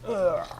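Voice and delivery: brief exasperated noise; groan. Real, not acted. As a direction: let out a quick "ugh". Groan while sorting items.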